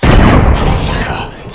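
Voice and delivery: hammed-up, drawn-out yell; shouted confession. Guy finally admitting it to his friends, shouted confession. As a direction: belt it out slowly as a mock admission.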